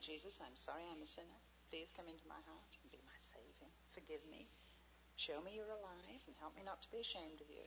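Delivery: soft, quiet bedside prayer; a humble prayer of repentance, softly; hushed prayer. Retold or imitated. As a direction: pray it gently, almost whispering.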